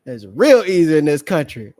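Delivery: laughing repeat. Real, not acted. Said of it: "Brother say that's real easy in this country".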